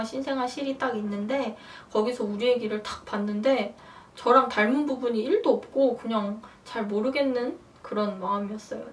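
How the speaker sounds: ordinary delivery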